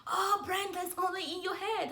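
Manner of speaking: high, loud mock coach shout; a coach yelling at a runner, mock shout; imitating coach's yell. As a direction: bark it like the coach yelling from the side. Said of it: "my coach always used to scream".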